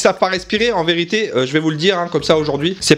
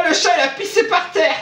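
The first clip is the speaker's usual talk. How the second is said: high-pitched, strained shout; hammed-up high wail; horrified outcry; 10 semitones higher than usual. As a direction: yelp it in outraged discovery. Re-enacts finding cat pee mid-pose.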